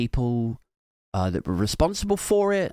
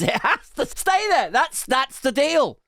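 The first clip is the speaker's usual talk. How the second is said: high, helpless mock insistence; 10 semitones higher than usual. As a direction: insist on it with pitched-up exasperation. Acted insistence to Keir.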